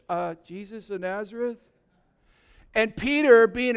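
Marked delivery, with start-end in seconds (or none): 0.1-1.6 hesitant, softer, with a questioning rise; sheepish. Acted. Fallen soldiers answer Jesus a second time, sheepish. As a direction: answer meekly, voice lifting into a question. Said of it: "they were a little sheepish".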